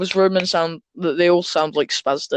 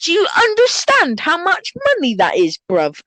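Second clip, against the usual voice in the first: high, aggrieved London street voice; mock roadman outrage, pitched way up; mock outraged roadman; 10 semitones higher than usual. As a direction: imitate an indignant young food critic. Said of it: "And he was like".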